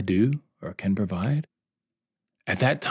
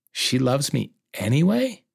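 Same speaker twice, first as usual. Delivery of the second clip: higher-pitched incredulous question; stunned disbelief; 6 semitones higher than usual. Retold or imitated. Husband realizing his wife still loves him, stunned disbelief. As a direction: ask it as if you can't believe it. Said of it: "I felt stunned".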